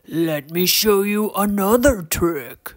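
gravelly troll showman; inviting troll growl; Torque. A narrator's reading. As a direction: offer it in a rough creature voice. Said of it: "deep and gravelly voice".

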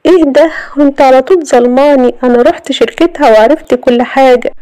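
high, loud wounded defence; indignant protest. A narrator's reading. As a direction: protest your innocence loudly. Accused woman protests in quarrel.

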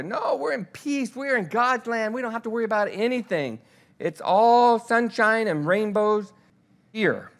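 mocking false prophet. Preacher mocks the false prophets' promises of peace, high sing-song mock. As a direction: lightly parody the easy reassurance.

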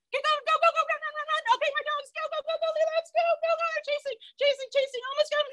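high, rapid rallying chant; playful chase call, staying way up high; excited chase commentary. Pretend. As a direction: urge the runners on, breathless and high. Urging kids in second chase.

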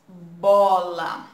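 put-on bola said loud and clear for children; modelled word. Shown as a demonstration. Teacher to children, saying bola loud and clear. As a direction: BOLA spoken out loud, stretched for the class. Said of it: "Olha aí o som da palavrinha".

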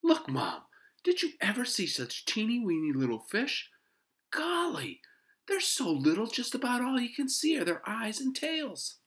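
put-on high, loud, excited boy; high-pitched, thrilled kid voice; delighted. A narrator's reading. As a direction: gush to Mom in boyish wonder. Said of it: "Henry was delighted".

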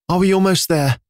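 high, tearful plea; weary mother close to tears; teary. A narrator's reading. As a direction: ask it near tears. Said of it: "looking ahead with watery eyes".

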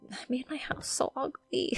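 whispered embarrassed confession; whispering. Real, not acted. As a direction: whisper it like a guilty secret. Ashamed aside about house.

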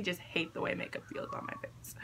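whispered complaint; whispering. Real, not acted. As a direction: whisper it to yourself. Complaint muttered under breath.

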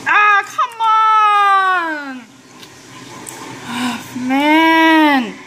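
very slow, high drawn-out groan; anguished wail. Real, not acted. Gamer failing the level again, a long high anguished wail. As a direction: stretch the complaint out in despair.

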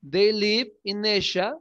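ordinary talk, nothing marked

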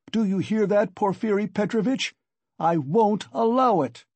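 acted shout of outrage. A narrator's reading. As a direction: challenge him in an angry outburst. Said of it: "he shouted".

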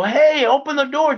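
high, retold demand through a door; insistent calling out. Demanding she open bathroom door.

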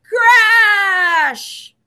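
high, loud shout; shouted crash. Shown as a demonstration. Reader leading children to shout along, loud and high. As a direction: yell it out for the kids to copy. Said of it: "Can you say that?".

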